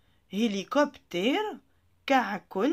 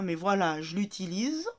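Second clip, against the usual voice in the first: normal talking voice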